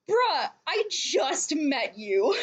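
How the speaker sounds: slow, drawn-out disbelief; incredulous. Retold or imitated. Reacting to the fake pregnancy excuse, slow incredulous. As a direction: stretch it out, deadpan baffled. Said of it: "I'm like".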